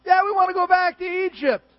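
high, loud, whining voice; whiny Israelites. Retold or imitated. Israelites whining to Moses, high mock whine. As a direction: whine like spoiled children.

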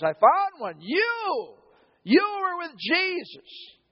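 high, pointed accusation, slowing on the last line; accusing servant girl. Acted. Servant girl accusing Peter at the fire, high and pointed. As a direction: jab the finger at him: you were with Jesus.

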